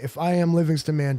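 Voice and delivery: ordinary delivery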